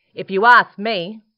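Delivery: loud, eager critter voice; loud platypus voice. Acted. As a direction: bold little animal character.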